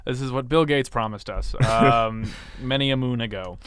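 ordinary talk, nothing marked

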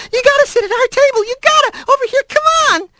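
put-on very high, excited mouse-child pleading; squeaky yelling. A narrator's reading. Little mice dragging Rat to their table, squeaky yelling. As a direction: squeal eagerly in a tiny voice. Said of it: "they were all yelling".

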